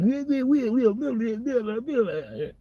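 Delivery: high-pitched gibberish imitation of a French officer; mock French rant. Police officer scolding a boy in French, high mock rant. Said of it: "police officer's going off in French".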